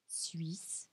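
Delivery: Suisse whispered, /sɥis/; whispered word. Shown as a demonstration. Teacher models Suisse for students, whispered. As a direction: breathe Suisse out softly. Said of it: "a ortografia não muda no feminino".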